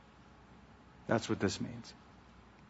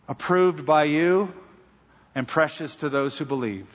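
pastoral prayer. Acted. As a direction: pray over the flock with reverent weight. Pastor leads closing prayer.

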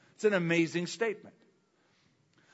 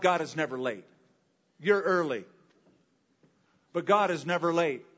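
ordinary delivery

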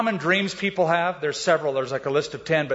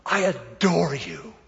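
slow dreamy adoring; slower than usual. Retold or imitated. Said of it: "She goes".

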